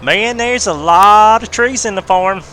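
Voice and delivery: high, loud and slow hillbilly drawl. Acted. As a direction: gawk at the trees like a lost farmhand. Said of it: "that's my country corner redneck voice".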